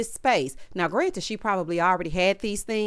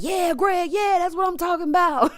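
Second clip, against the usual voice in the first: excited cheer; 7 semitones higher than usual. Retold or imitated. Groom's friend hollering approval at the wedding, high and excited. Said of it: "He was like".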